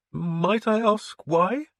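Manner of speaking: the priest doubting the lord's sudden faith; suspicious priest. A narrator's reading. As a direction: test him, the suspicious priest.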